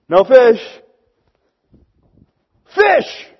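loud, jumping up to a high cry on the second "fish"; startled discovery. Retold or imitated. Fishermen hauling the net, astonished. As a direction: exclaim at a sudden catch.

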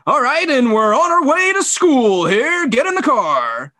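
put-on high, loud broadcaster voice talking to the kids; announcer voice at home. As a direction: hype up the school run like a live broadcast. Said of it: "you're doing this announcer voice".

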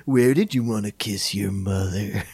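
deep mock analyst on a hotline; Sigmund Freud. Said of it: "the one nine hundred Sigmund Freud sex call line".

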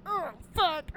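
playful mock delight; mock aroused moan. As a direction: act turned on by the insult. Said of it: "they'd be like".

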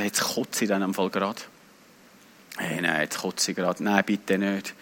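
very low, soft inner plea; hushed, low dread of being sick; queasy self-talk. Retold or imitated. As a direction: mumble it low to yourself, feeling sick. Acts queasy thoughts on the plane.

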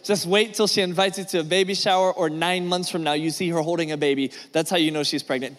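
ordinary talk, nothing marked

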